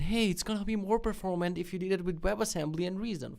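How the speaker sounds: high mocking commenter voice; know-it-all commenter. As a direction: mimic a pedantic internet commenter. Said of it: "people in the comments are rewriting it".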